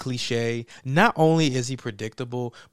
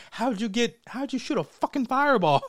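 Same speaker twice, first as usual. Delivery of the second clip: higher amazed mock voice; hammed-up amazement; mock amazed Kano; 8 semitones higher, 3 dB louder than usual. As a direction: gawk like a stunned crook.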